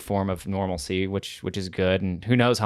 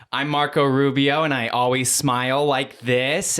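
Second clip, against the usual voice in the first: slow, higher mock politician voice; bad sketch impression announcing itself; put-on voice through an exaggerated smile; Marco Rubio; 5 semitones higher, 3 dB louder, slower than usual. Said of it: "the guy who played Marco Rubio".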